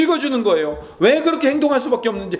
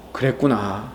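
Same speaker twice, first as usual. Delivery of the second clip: much lower and softer, tender; a quiet, understanding comfort; whisper-soft 그랬구나 to yourself; consoling; 11 semitones lower, 5 dB quieter, slower than usual. Pretend. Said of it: "하고 위로해 주시고".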